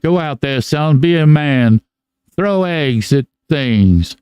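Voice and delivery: put-on an Old West gunslinger accent, slow and a touch high; Doc Holliday accent. Drunk father urging his son to throw eggs, slow Old West accent. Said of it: "cool Doc Holliday accent".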